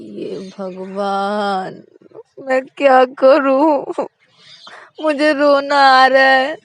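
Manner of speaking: slow, loud, drawn-out wail; about to cry, stretch the words; whiny near tears. A narrator's reading. (रोने जैसी खिंची हुई आवाज़)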